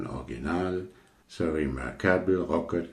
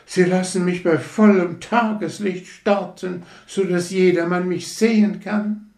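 begeisterte Rakete; 12 semitones higher, 5 dB louder than usual. Acted. Rakete freut sich weiter.

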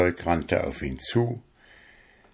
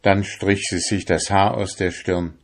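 plain reading voice

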